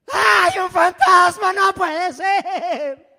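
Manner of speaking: very loud, high, slow hammed-up scream of panic; terrified scream. Shown as a demonstration. Preacher acting out the disciples' panic, a huge drawn-out scream. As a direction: wail it out like a fisherman seeing a ghost. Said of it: "así lo leemos bonito".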